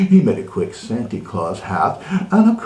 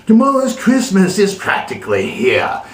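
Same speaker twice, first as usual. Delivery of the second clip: higher, louder sneering snarl; Grinch snarl; 7 semitones higher, 4 dB louder than usual. Acted. Grinch snarling at the coming Christmas. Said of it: "He snarled with a sneer".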